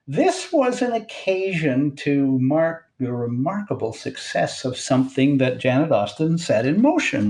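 normal talking voice

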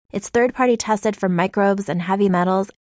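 normal talking voice

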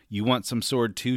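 ordinary delivery

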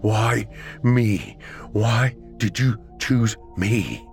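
anguished plea. A narrator's reading. Ruined man asks the monster why, slow and anguished. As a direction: plead for an answer, drawing each word out. Wounded victim begs monster for reason.

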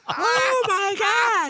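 hammed-up high-pitched panic; little-girl scream. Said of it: "screaming like a little girl".

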